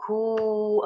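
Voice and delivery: كو with the oo held out; long vowel. Shown as a demonstration. Teacher writing out the long sound, كو drawn out. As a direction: stretch كو on a level note. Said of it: "الصوت الطويل".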